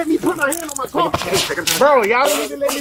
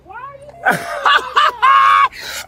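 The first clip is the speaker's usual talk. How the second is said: mocking laugh; 12 semitones higher than usual. Real, not acted. Laughing at police.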